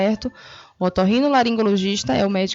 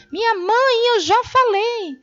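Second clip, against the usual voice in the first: high, drawn-out exasperated tone; irritated grown child; 12 semitones higher, 3 dB louder, slower than usual. Acted. Child repeating themselves to hard-of-hearing mother, exasperated. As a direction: snap impatiently at mother, pitched way up. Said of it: "nesse tom que não é agradável, né, do filho".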